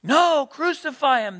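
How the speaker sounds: loud, high cry of protest; crowd crying out. Acted. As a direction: cry out like a demanding mob. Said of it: "they all cried out".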